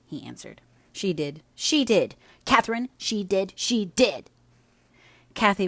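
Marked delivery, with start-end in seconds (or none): 0.9-4.3 taunting repetition. Acted. Linton taunting Cathy again.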